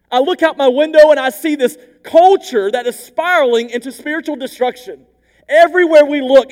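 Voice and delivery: high, loud anguished plea to God; desperate cry. Retold or imitated. Habakkuk crying out to God over evil, loud anguished plea. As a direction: cry out as Habakkuk who feels abandoned. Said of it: "Habakkuk's cry to God".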